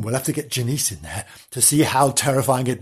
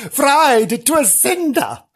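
laughing; 11 semitones higher, 3 dB louder, much slower than usual. Real, not acted. Gleeful joke about burnt ghost.

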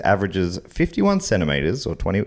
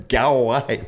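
lightly voiced go away call; mock bird call; 4 semitones higher than usual. Shown as a demonstration. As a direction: chirp it like the bird. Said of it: "'cause it, it says".

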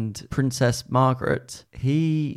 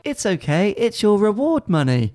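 higher, sugary reassurance; sweet princess voice; 7 semitones higher than usual. Retold or imitated. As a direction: brush it off sweetly. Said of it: "she's like".

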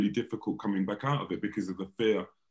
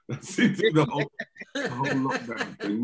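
laughing; 4 semitones higher than usual. Real, not acted. Joking lockdown will continue.

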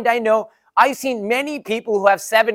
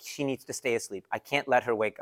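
hushed; 7 semitones lower, 11 dB quieter than usual. Retold or imitated. Not waking sleeping baby.

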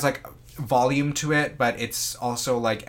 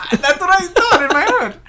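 laughing through the words; words squeezed out mid-laugh; giggling at her own thought; 12 dB louder than usual. Real, not acted. Cracking up over the joke.

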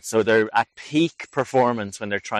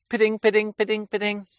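high, piping two-note bird call; ringing birdsong imitation, far above speaking voice; great tit call imitation; 12 semitones higher than usual. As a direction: mimic a great tit, repeated and pitched right up. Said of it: "another great tit behind me here going".

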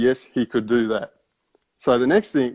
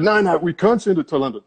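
higher-pitched mock irate refusal; 7 semitones higher than usual. Scammer refusing London on the phone, mock irate. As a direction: snap back as the annoyed scammer. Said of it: "He was quite irate".

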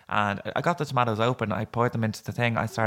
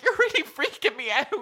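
high-pitched, half-laughing mock distress; retold freak-out. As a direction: wail it, amused at yourself. Crying patient answers doctor, retold.

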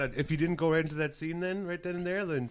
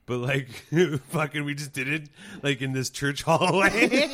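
ordinary talk, nothing marked

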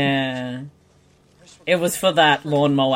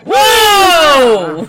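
startled whoa; 10 semitones higher, 7 dB louder than usual. Real, not acted. Shocked by exploding bodies.

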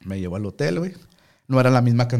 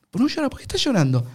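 put-on Argentine accent; 13 semitones higher, faster than usual. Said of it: "el argentino".

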